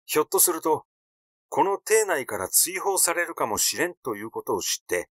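ordinary delivery

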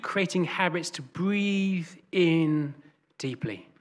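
unhurried spoken prayer, leaving room to breathe. Real, not acted. Leads congregation in prayer.

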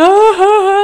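loud, high mock wail; imitated child's scream. Mother imitating her screaming child, loud wail. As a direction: let out a child's cry at full volume. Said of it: "und schreit dann".

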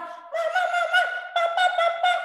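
put-on high child's call for parents; child interrupting. Shown as a demonstration. Teacher acting a pupil interrupting, high calling. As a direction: mimic a kid butting in. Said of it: "Kalau papa mama lagi bicara bilang".

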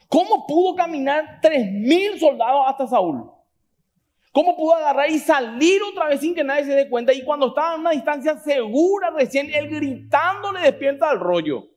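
fervent preacher, stretching key words; impassioned preaching. Real, not acted. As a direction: drive the point home to the congregation. Preaching at full force.

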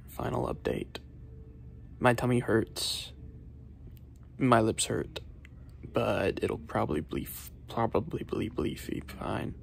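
fading to a whisper; trailing off feebly; weak fading voice. Real, not acted. Fading, sick from hot sauce.

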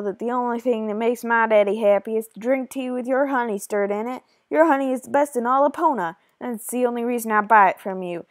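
put-on loud, bossy Western accent; hammed-up Western villain, pushing and threatening. Junior threatens to take his money elsewhere, Western accent. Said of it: "I tried to do a Western accent".

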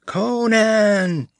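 high piercing shriek of a name. A narrator's reading. Valerius shrieking for Conan across the square. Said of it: "shrieked Valerius".

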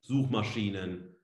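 normal talking voice

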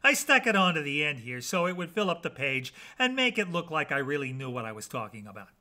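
ordinary delivery, nothing marked

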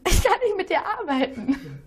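giggling through the words; laughing. Real, not acted. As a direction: say it while cracking up. Colleague can't stop laughing.

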